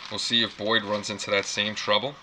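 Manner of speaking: usual speaking voice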